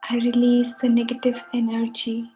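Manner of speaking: leading a relaxation, letting each phrase settle; guided meditation. Acted. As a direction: guide listeners into calm, phrase by unhurried phrase. We are stepping onto a guided meditation.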